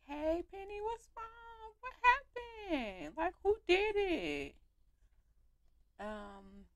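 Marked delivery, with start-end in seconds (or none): 0.1-4.6 high, pleading concern; worried friend. Pretend. Voicing the character asking Penny what happened, high concerned. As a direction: coax her to tell who did it. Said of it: "Hey, like".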